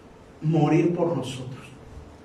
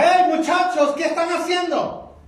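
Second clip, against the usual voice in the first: calling out; 12 semitones higher than usual. Acted. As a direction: hail the fishermen from the shore, much higher. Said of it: "Y le grita".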